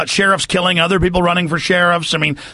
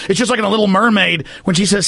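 ordinary delivery

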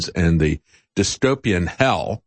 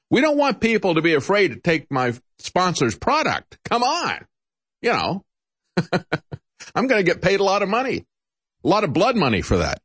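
high-pitched satirical pleading; mock sponsored moderator; 9 semitones higher than usual. Host mocking moderator protecting his sponsor's product, high-pitched parody. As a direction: parody a paid shill, pitch raised throughout.

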